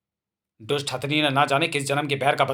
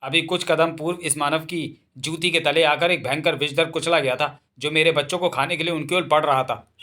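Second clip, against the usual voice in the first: usual speaking voice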